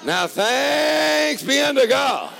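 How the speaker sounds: high, loud, slow preacher's shout; preaching at full force. Acted. Preacher lifting the congregation in praise, loud and slow. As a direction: lift it up and ring it out.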